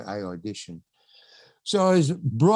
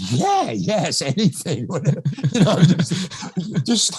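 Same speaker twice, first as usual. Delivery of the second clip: high, loud, laughing eagerness; over-eager agreement; 5 semitones higher, 4 dB louder, slower than usual. Retold or imitated. Young actor agreeing to the director's haircut, wildly eager. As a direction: blurt it out, climbing into a mock shout.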